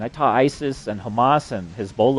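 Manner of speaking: usual speaking voice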